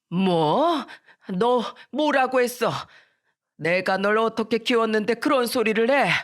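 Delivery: put-on old woman's hurt disbelief; shocked grandmother. Grandmother stung by granddaughter's words, shocked and hurt. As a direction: reproach as a stunned grandmother. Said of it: "미란이 말에 충격을 받고".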